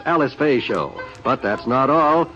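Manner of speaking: ordinary talk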